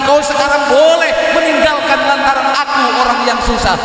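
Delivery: a scorned man swearing he will rise, voiced with growing fire; defiant vow. Scorned man vowing to the woman who left him, defiant. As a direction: throw the vow back at the one who left, lightly acted.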